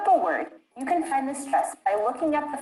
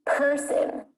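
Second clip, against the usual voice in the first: "Person" with an overdone, higher first syllable; exaggerated word stress; 4 semitones higher than usual. Shown as a demonstration. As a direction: hit the first syllable of "Person" hard, let the second go. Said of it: "I was exaggerating the stress".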